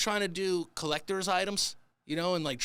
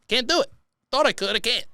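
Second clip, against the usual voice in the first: higher, louder Can't do it; louder resigned cry; 6 semitones higher, 4 dB louder than usual. Shown as a demonstration. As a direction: blurt Can't do it out loud.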